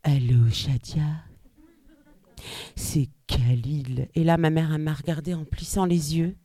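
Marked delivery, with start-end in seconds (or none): 0.0-1.0 low, smooth seducer's voice; suave suitor. Retold or imitated. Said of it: "avec une voix suave". 2.8-4.1 very slow, low seducer's voice; suave suitor. Retold or imitated. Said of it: "avec une voix suave".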